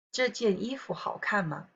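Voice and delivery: ordinary talk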